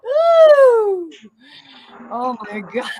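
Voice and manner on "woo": a high laughing cry breaking into a loud woo; laughing cry then whoop. Real, not acted. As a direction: let it all out, laughing and cheering. Ecstatic laughing crying shout.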